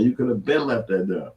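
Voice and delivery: ordinary delivery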